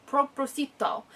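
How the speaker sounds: propósito said with Spanish sounds; Spanish word. Shown as a demonstration. Teacher showing a Spanish word, Spanish pronunciation. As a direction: give propósito its Spanish vowels. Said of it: "that's a Spanish word".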